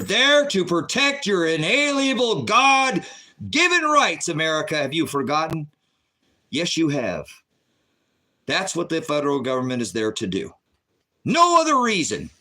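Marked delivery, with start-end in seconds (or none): 0.0-4.8 high, slow preacher's shout; impassioned yell. Real, not acted. Host reminding America of its rights, impassioned yelling. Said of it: "I like to yell a bit in here". 11.2-12.3 high, loud declaration; impassioned yell. Real, not acted. Host insisting on government's one purpose, impassioned yelling. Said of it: "I like to yell a bit in here".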